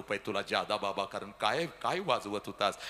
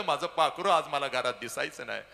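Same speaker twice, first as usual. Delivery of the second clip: ordinary talk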